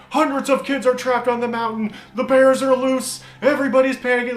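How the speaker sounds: high, loud mock newscast; alarmist news reporter. Reporter live from the flooded camp, mock-sensational. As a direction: imitate a reporter hyping a disaster. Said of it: "news vans were already up there reporting".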